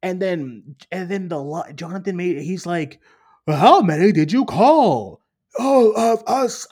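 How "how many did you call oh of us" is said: higher, louder, slowed mimic of the villain crowd; mock Kang council voice. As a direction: ham up the eager Kangs calling out. Retelling the Kang council scene.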